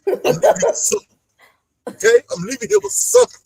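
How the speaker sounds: lightly imitated movie star, fast; quick breathy line like a movie hero; Denzel. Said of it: "you cue up Denzel".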